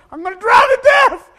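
high, loud terrified shout; drowning boy crying out in panic; panicked cry. Retold or imitated. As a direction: scream as the water takes you. Drowning in the undertow.

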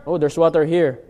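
ordinary talk

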